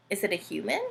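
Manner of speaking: usual speaking voice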